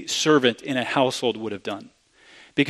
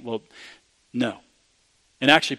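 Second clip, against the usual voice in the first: ordinary delivery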